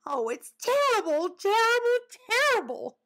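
loud, very high-pitched lament; horrified wail. Acted. Woodchuck recoiling at three Grandpas, wailing in horror. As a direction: cry out each word in melodramatic horror. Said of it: "Sammy recoiled in horror".

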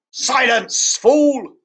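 sharp, loud witch command; angry snap. Acted. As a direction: cut him off with scorn. Witch snaps at Edmund.

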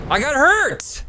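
high, startled protest; high outraged cry; indignant yelp. Real, not acted. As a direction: yelp it in disbelief. Gamer protesting taking damage.

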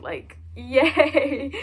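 loud, giggly little yay; excited laughing yay; laughing cheer. Real, not acted. Unboxing a bobblehead.

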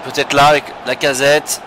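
live match commentary, building energy; play-by-play commentary. Acted. Live commentary on his own match.

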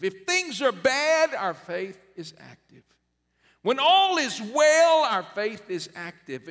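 high, loud, ringing pulpit cry; shouted sermon refrain; preaching at full force. Acted. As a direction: preach it out to the back row.

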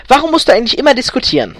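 fast, high, loud complaint; exasperated nagging. A narrator's reading. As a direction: nag your husband quickly and loudly. Wife nagging husband in story.

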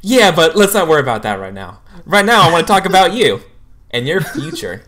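eager. Acted. Counselor steering the student's talk, eager and loud. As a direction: sweep the problem aside brightly.

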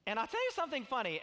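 ordinary talk, nothing marked

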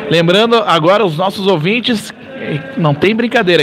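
normal talking voice

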